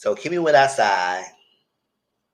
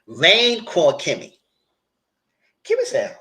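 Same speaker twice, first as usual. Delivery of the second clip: ordinary delivery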